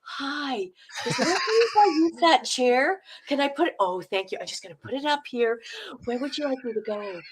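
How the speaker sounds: soft, hesitant, apologetic little requests; polite Canadian auditioner. Acted. Canadian walking into an audition for a role, timid and polite. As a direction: ask gently, as if afraid to impose. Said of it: "this is how a Canadian goes in".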